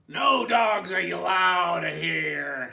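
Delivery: loud, slow, drawn-out shout; yelled. Acted. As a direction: bark it out as a rule. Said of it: "Hank yelled".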